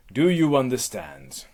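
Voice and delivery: loud, demanding question; imperious and forceful; stern Hera. Acted. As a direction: demand an answer as an angry goddess. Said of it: "back to Hera".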